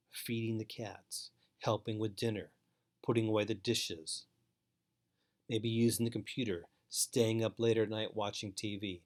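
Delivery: normal talking voice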